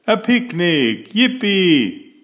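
high-pitched, gleeful exclamation; excited cheering. A narrator's reading. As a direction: cheer like children hearing great news. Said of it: "everyone shouted".

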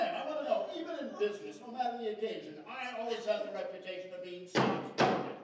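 loud, indignant self-defence. Acted. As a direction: protest loudly that you are mild. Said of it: "without raising your voice".

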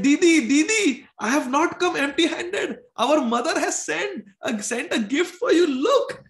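put-on high, eager child's voice; a young brother overjoyed to find his sister; excited little boy. Boy brings gift from mother.

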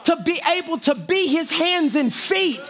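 rising shouted preaching cadence. Acted. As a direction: preach it to the congregation at full force. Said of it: "I'm preaching up here, y'all".

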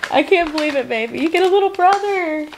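high, emotional happy voice; overwhelmed with happiness, near tears; tearful joy. Real, not acted. Reveal, mother tearful joy.

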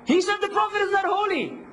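high-pitched indignant accuser impression; mock outrage. Teacher mimics accuser reporting him, high mock outrage.

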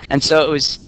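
ordinary delivery

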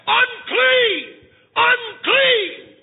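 loud, high wailing "Unclean!"; leper's cry. Acted. Leper warns a passing rider with a loud, high cry. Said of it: "scream to the top of your voice".

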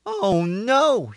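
high, upset little rooster; a rooster voice, high and dismayed; dismayed rooster cry. A narrator's reading. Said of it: "he cried".

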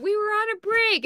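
high-pitched mock protest; TV catchphrase. Cohost swapping in a famous line, high mock indignant quote. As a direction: quote it like an exasperated outburst. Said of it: "instead of what's his bucket".

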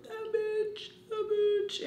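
very high-pitched mock squeal; high, held-out mock fury; imitated outraged mom. Mom's imagined outrage.

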